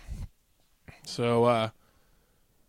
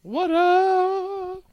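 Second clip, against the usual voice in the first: high, long-held singsong whoop; hype call; 13 semitones higher than usual. Pretend. Co-host hyping each beer listed, high singsong. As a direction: hold the last note up high.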